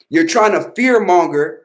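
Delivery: usual speaking voice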